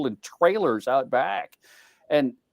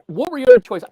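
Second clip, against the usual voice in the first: indignant retold question; 8 semitones higher, 9 dB louder, faster than usual. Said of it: "he said".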